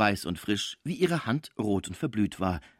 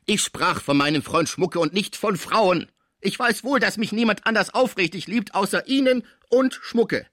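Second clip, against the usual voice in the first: angry insistence; 11 semitones higher, 6 dB louder than usual. A narrator's reading. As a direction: insist heatedly, stressing word after word. Angered patient continues his outburst. (aufgebracht und nachdrücklich)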